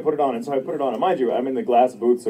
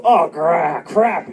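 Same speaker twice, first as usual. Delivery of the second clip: mock annoyed; 6 semitones higher, 6 dB louder, much slower than usual. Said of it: "the way someone else would go".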